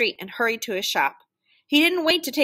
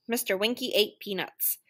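usual speaking voice

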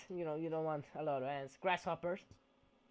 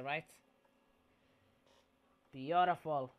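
usual speaking voice

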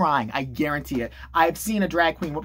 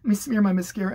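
mock victim; 4 semitones higher than usual. Influencer fussing over looking victimised, mock pitiful. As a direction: play up fake victimhood. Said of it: "She made this whole diatribe about it, like".

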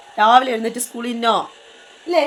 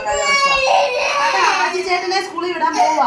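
high sing-song; 8 semitones higher, 4 dB louder than usual. Real, not acted. Mother over a crying child.

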